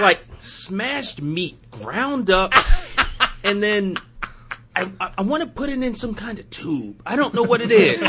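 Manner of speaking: high, loud, laughing Stan Lee voice; hammy Stan Lee fumbling for words; Stan Lee impression. Podcaster mimicking Stan Lee describing sausage, high and laughing. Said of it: "he would say".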